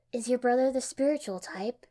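gently inquisitive question; curious. A narrator's reading. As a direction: ask with real curiosity. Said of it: "she asked curiously".

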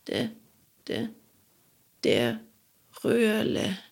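faint halting dying gasps; death rattle. Acted. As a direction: rattle out the name with last breath. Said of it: "röchelte".

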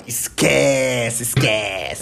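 a lightly hammed-up player gloating after two goals; boastful footballer. Footballer after two beautiful goals, mock swaggering dismissal. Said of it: "aí fala".

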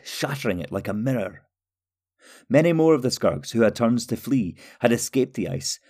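ordinary delivery, nothing marked

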